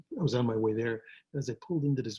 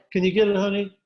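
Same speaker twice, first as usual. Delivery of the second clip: raised call to the next room; calling out to wife; 8 semitones higher, 7 dB louder than usual. Real, not acted. Husband calls wife to get the door, a raised call. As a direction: call out to your wife, pitched up.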